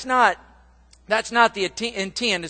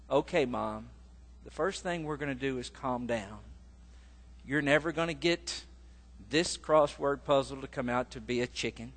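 calm son; 5 semitones lower, 10 dB quieter than usual. Retold or imitated. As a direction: lower and much softer, soothing son. Son calmly soothing his mother.

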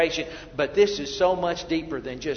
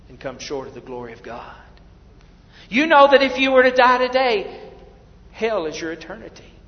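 ordinary talk, nothing marked

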